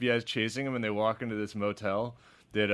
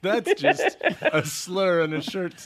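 laughing; 7 semitones higher, 5 dB louder, slower than usual. Real, not acted.

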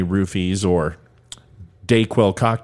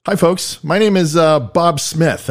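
higher, cheesy pretend host Bob Smith; fake host persona; 7 semitones higher, slower than usual. Host pretends to be someone else, cheesy host voice. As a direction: greet like a fake bland TV host. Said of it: "No, actually, my name is [name]".

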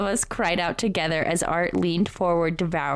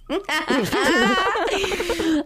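high, loud, long laugh; 11 semitones higher, 4 dB louder than usual. Real, not acted. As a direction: crack up laughing. Laughs before continuing.

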